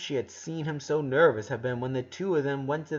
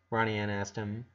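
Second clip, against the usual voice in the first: ordinary delivery, nothing marked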